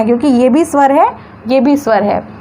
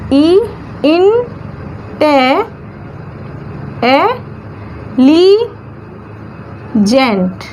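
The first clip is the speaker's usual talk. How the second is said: "इन, टे, ली, gent" as isolated stretched beats, rising until the falling gent; syllable by syllable; much slower than usual. Shown as a demonstration. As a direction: sound out "इन ... टे ... ली ... gent" with long pauses. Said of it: "चार syllable दिखाई दे रहे हैं".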